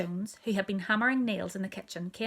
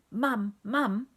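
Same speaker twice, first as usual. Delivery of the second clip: lightly voiced baby chant; a louder, punchy baby babble; hint of a toddler repeating herself; babbling baby; 2 semitones higher, 5 dB louder than usual. A narrator's reading. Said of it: "my little sister was saying".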